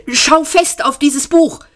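urgent, high-pitched pleading; imploring hiss. A narrator's reading. Ford tries to steady frantic Arthur, imploring and urgent. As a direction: press the order on him imploringly. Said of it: "zischte er beschwörend".

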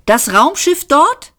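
high-pitched, eager question; suddenly excited. A narrator's reading. Ford asks the robot about the police ship, suddenly excited. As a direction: jump up in pitch with sudden interest. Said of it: "fragte Ford plötzlich ganz aufgeregt".

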